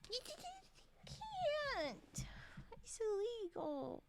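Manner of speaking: high wailing mock sob, stammering; pouty mock crying. Streamer wails at her model, pouty rage puff. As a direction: whine in fake tearful outrage. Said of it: "A very pouty rage puff".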